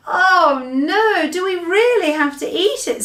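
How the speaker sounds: put-on high, whiny dismayed girl; a girl begging not to. Sophie dismayed.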